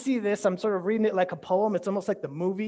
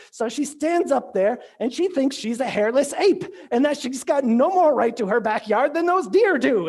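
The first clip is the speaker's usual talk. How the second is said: louder, higher, indignant mock Scottish preacher; angry Scottish impression; 6 semitones higher, 7 dB louder than usual. Preacher mocking the woman at the town meeting, angry Scottish. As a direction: fume it out in a harsh Scottish accent. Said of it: "he's all angry and he's got that harsh Scottish accent".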